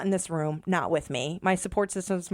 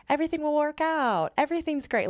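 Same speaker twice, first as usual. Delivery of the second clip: imitating the husband, pitched much higher and sunny; a hammed-up relentless optimist shrugging it off; husband's upbeat reassurance; 10 semitones higher, faster than usual. Wife mimics her unflappable husband, higher, cheery. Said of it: "he is like that Michael Scott".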